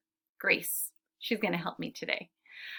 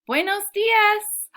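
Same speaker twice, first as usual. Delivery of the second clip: very high, loud singsong greeting; calling out; 12 semitones higher, 8 dB louder, much slower than usual. A narrator's reading. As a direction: call it out slowly to someone far away. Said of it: "Senor Enrico calls".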